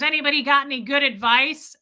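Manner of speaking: retold shout-out to a crowd; dad calling out. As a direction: put the question to the whole bar. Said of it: "he says out loud".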